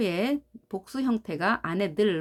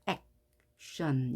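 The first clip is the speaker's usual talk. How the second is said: "action" broken as ac, pause, tion; split syllables; 5 semitones lower, 5 dB quieter than usual. Shown as a demonstration. Teacher divides between consonants, "action" with a gap after ac. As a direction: say "action" in two separate pieces. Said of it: "갈라놓습니다".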